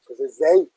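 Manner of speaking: zei said loud, stress on the open E; zei, open E carrying the accent; open E demo. Shown as a demonstration. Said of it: "tiene un tono alto en la segunda sílaba".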